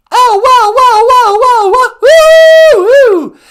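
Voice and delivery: very high, very loud rock star whoa; rock star wail. Acted. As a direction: wail it like a rock singer on stage. Rock star caller entrance.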